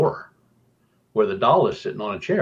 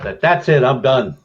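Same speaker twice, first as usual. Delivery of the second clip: louder, higher, fed up; retold outburst; 4 semitones higher, 6 dB louder than usual. Investigator tells the team he is quitting, loud. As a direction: slam the door on it, I'm out. Quitting after the doll talks.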